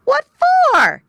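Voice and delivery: high, loud playful otter voice; Little Joe Otter. A narrator's reading. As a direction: toss the question back lightly. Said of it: "asked Little Joe".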